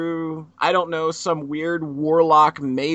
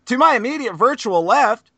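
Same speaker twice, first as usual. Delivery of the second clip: announcer build-up; 8 semitones higher, 4 dB louder, slower than usual. Pretend. Introducing a special guest.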